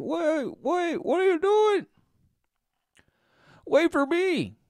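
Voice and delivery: very high, squeaky little-people voice; squeaky tiny voices. Retold or imitated. Tiny people calling after the others in very high squeaks. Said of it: "all the bashes up here are saying".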